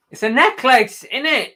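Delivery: loud, high "necklace" as neck-lace; emphatic pronunciation. Shown as a demonstration. Arguing back over the word, loud insistent neck-lace. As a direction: belt out "necklace" with a full "lace". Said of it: "You're a numpty".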